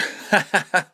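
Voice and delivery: awkward nervous chuckle; uncomfortable laugh. Shown as a demonstration. Demonstrating his awkward laugh at the drunk guy, nervous chuckle. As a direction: show the uneasy laugh. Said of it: "I just laugh like that".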